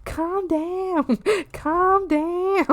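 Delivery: high, soft, drawn-out plea; cute soothing frog. Pretend. Frog calming the grumpy ones, cute soothing. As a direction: coo it in a silly high voice.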